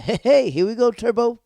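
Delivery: high, loud, teasing cheer; hyped-up reaction. Acted. Recapper cheering on Peyton's bold invite, hyped-up. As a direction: shout it like a hype man.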